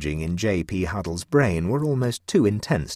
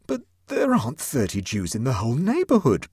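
protested; 6 semitones higher, 3 dB louder than usual. A narrator's reading. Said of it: "protested Huddle".

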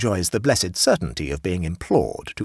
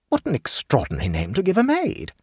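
a hint of a fussy higher hostess; lightly voiced hostess; 12 semitones higher than usual. A narrator's reading. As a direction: raise the pitch for the chatty hostess.

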